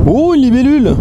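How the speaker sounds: sudden high delighted gasp; surprised. Real, not acted. Spots a dragonfly landing nearby.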